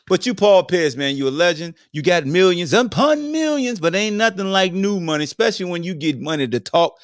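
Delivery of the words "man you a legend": usual speaking voice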